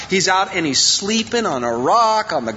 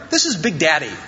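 normal talking voice

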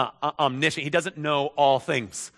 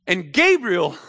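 ordinary talk, nothing marked

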